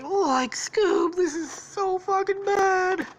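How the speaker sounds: very high, whimpering cartoon voice; high, drawn-out slacker whine; distressed Shaggy. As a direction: wail to a friend in mock despair. Wounded Shaggy to Scooby.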